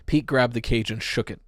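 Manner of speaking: usual speaking voice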